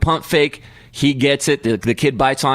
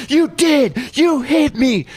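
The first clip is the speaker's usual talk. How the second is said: son's accusation; 13 semitones higher, slower than usual. Retold or imitated. Son tells the mom his dad hit him, high shout. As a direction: insist like a wronged child. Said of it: "and he goes".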